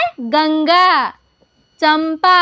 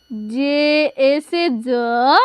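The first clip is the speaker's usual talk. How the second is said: slow letter by letter; patient teacher spelling; much slower than usual. Shown as a demonstration. Teacher slowly spells a name for pupils. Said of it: "देखिए".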